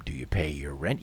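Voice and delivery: low, soft, impassive worker's voice; indifferent official. Acted. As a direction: dismiss them in a low, unmoved voice. Indifferent mover answers.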